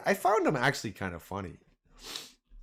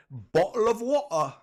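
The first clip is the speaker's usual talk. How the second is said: mock British accent; 11 semitones higher, 6 dB louder than usual. Imitating kids walking around in a mock British accent. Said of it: "my kids always walk around like".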